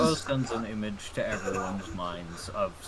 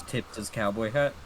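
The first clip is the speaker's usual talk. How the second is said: ordinary delivery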